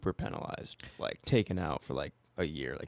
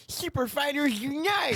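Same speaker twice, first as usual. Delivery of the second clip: hype slogan cheer; 13 semitones higher than usual. Pretend. Mock rebrand slogan, laughing.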